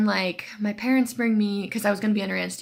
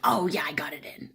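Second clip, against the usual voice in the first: whispered relief; 4 semitones lower than usual. Retold or imitated. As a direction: breathe it out, relieved. Nurse finally succeeds quietly.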